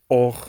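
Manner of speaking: "or" ending in a French-style throaty R; guttural R. Shown as a demonstration. Teacher quoting modern Hebrew speakers, guttural R. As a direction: say "or" with a back-of-throat R. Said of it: "German R".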